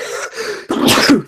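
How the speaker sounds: sudden sharp sneeze; sneezing. Real, not acted. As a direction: let out a real sneeze. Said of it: "I sneezed".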